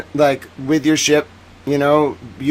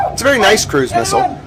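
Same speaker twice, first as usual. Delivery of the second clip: ordinary delivery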